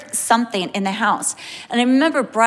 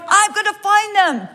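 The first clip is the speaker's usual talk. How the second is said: determined hunter; 9 semitones higher, 3 dB louder than usual. Retold or imitated. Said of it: "he's running past the door going".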